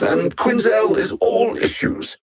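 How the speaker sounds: sharp, high mocking taunt; mocking jab. Alien villain mocking Harley Quinn with a high sneer. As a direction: spit the insult up high.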